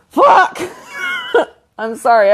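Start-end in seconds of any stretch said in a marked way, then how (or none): 0.1-0.6 high, loud frustrated shout; annoyed outburst. Real, not acted. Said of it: "I just get annoyed". 0.9-1.4 short high-pitched laugh. Real, not acted. Laughs after her own outburst.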